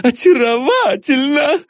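very high, squeaky fawning voice; high-pitched cooing flatterer; gushing admirer. A narrator's reading. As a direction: gush with delight in a high falsetto. Fussy admirer fawning over his guest.

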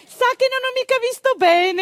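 teasing midwife. Retold or imitated. Gilda teases the men waiting for a boy, falsetto coy. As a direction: play dumb with a sly little lilt.

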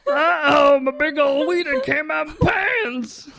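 high shouting dopey dinosaur; Carl the dinosaur. Pretend. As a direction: blurt like a clueless dinosaur. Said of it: "That's what he sounds like".

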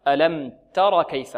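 تر slow and short, no long alif; slow demo. Shown as a demonstration. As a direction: read تر slowly and clip it. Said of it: "لا نقول ألم ترى كيف".

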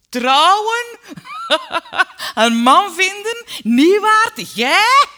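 very loud, high, incredulous guffawing; mocking father roaring with laughter; choking with laughter. A narrator's reading. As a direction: howl it out between bursts of laughter. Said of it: "verslikte zich van het lachen".

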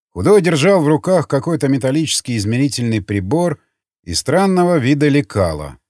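usual speaking voice

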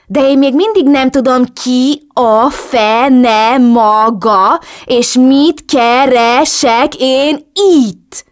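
loud, high, very drawn-out syllables; slow, syllable by syllable. A narrator's reading. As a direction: spell it out syllable by syllable, as if to someone slow. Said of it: "Lassan szótagolva beszéltem". (lassan, szótagolva, hangosan)

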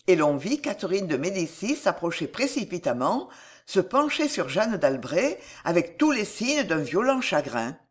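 usual speaking voice

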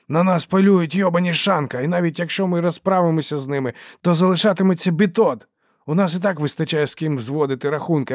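loud, high, urgent scolding; exasperated outburst. A narrator's reading. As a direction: shout them down and settle it. Dogman shouts down the quarrel. (обурено, гучно, високим голосом)

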